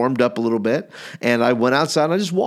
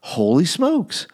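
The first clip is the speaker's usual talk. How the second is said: slow, higher exclamation of affront; mock offended; 4 semitones higher, slower than usual. Sleeper reacting to excuses sent after him, mock offended. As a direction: draw it out as if insulted. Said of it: "I just got, like, offended".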